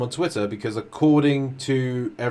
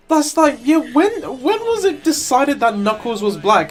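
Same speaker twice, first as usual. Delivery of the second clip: high, loud incredulous rant; excited disbelief; 14 semitones higher, 6 dB louder than usual. Real, not acted. Friend ranting to co-host about Knuckles, loud excited disbelief. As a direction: pitch it up in disbelief.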